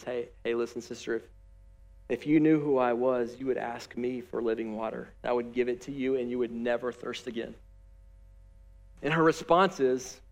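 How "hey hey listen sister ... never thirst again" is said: gentle Jesus. Acted. Jesus speaks gently to woman.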